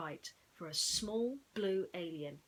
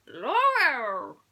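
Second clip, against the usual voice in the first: high, loud alien cry; exclaimed; 5 semitones higher, 11 dB louder than usual. Acted. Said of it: "he exclaimed".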